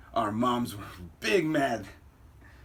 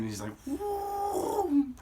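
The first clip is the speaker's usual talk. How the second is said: imitated whoop; 3 dB quieter, much slower than usual. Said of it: "I love that sound from the Uncle Aaron".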